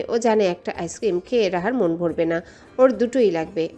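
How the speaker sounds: ordinary delivery, nothing marked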